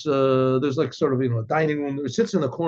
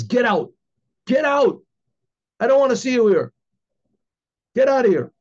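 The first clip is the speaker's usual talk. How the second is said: higher, louder shout of get out; furious order to leave; 6 semitones higher, 4 dB louder than usual. Acted. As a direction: throw him out with a raised voice. Baal Shem Tov expels the man.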